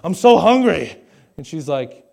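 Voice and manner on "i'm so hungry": loud, higher-pitched hammed-up complaint; mock hungry whine. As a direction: belt out a mock complaint of hunger. Retelling himself complaining to wife.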